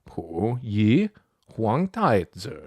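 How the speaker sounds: slow "Pu Yi Huang Dai Zi" with rising glides; careful tonal model of "Pu Yi Huang Dai Zi"; corrected tones. Shown as a demonstration. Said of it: "Like this".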